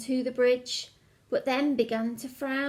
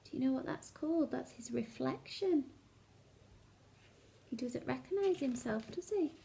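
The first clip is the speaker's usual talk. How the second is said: soft, quick hushed asking; quiet aside to children; 11 dB quieter, faster than usual. Acted. Storyteller pausing to ask listening children, soft and quick. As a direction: lean in and ask the kids softly.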